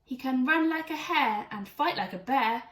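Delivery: ordinary delivery, nothing marked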